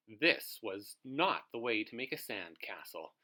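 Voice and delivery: ordinary delivery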